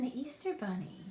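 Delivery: ordinary delivery, nothing marked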